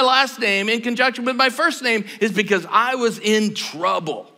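fervent preacher; preaching at full force. Acted. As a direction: preach it out to the room. Preaching about guilt.

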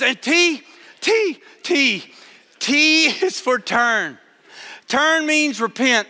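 an overeager beginner tumbling ahead; excited stammer, slowing on the meaning. Retold or imitated. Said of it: "She got so excited".

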